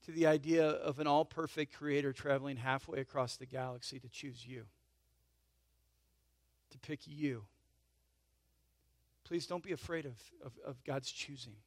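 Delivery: soft, low and intimate appeal; tender, hushed. Real, not acted. Pastor tells congregation a creator chose them, tender and hushed. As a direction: drop down gently to reassure them.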